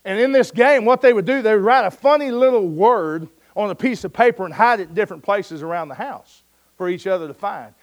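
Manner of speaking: normal talking voice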